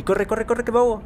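high, frantic cries to run; panicked running. Real, not acted. Player fleeing rising water so not to drown, frantic high voice. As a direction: urge yourself on in panic, pitched high.

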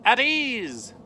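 loud, high commanding call; shouted name. A narrator's reading. Estrella calling her snake, loud commanding shout.

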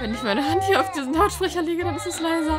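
high, loud, agitated chatter; loud repeated calm-down, far from calm; frantic over crying. Real, not acted. Talking over the screaming doll.

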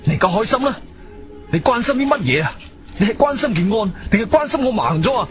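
high, indignant reproach; angry. Acted. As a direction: throw bitter questions at him. Blinded man rages at superintendent.

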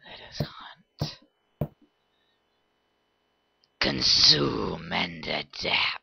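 slow, whispered monster voice; Kha'Zix. Retold or imitated. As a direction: whisper it like a hunting insect beast. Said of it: "I'm quoting Kha'Zix from League of Legends".

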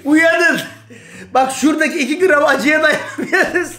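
mock preaching. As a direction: preach it to the faithful. (vaaz verir gibi bağırarak)

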